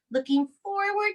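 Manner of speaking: ordinary delivery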